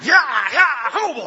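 loud, high riding whoop; riding yell. Acted. Cowboy hero spurs his horse on with a yell.

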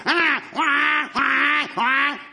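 repeated mock donkey braying; imitated donkey bray. Preacher showing what the donkey didn't do, mock braying. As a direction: bray out short honking cries like a donkey. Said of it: "it was not the donkey going".

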